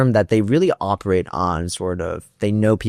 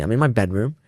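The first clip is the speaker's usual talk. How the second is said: ordinary talk, nothing marked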